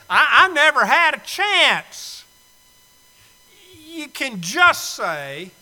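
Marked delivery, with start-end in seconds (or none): 0.1-2.2 mock excuse. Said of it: "You can't say".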